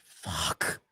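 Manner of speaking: breathed out under the breath, very soft; dismayed whispered curse. Retold or imitated. Kid facing Granny's switch, dismayed whisper. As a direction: whisper it in resigned dread. Retold dread at grandmother's threat.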